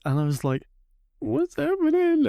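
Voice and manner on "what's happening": put-on upset child. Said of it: "I was really upset".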